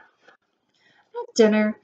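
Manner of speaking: ordinary delivery, nothing marked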